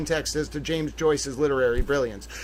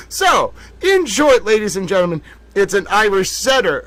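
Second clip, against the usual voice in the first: shouted reveal; 5 dB louder than usual. Real, not acted. Host revealing the dog is a setter, shouting. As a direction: announce the punchline big.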